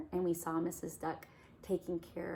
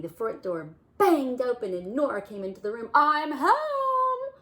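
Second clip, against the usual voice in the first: loud cheerful arrival; 7 semitones higher, 12 dB louder than usual. Acted. Nora bursts in loudly.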